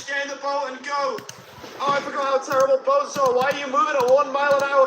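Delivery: loud, high frustrated shout; furious gamer rant; exasperated yell. Real, not acted. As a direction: vent at the useless boat. Raging at slow boats.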